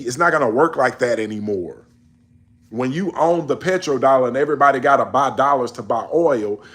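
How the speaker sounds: ordinary talk, nothing marked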